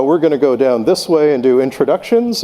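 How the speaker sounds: ordinary delivery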